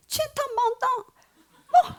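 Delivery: high, singsong imitation Chinese with a laugh; mock Chinese tongues. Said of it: "중국어로 막 방언을". (중국어 방언 흉내)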